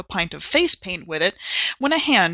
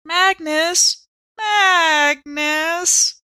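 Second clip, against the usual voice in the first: drawn-out, louder moaning of the name; mock animal lowing; 8 semitones higher, 5 dB louder, much slower than usual. A narrator's reading. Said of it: "chorused his name in slow, hurt animal noises".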